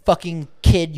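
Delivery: ordinary talk, nothing marked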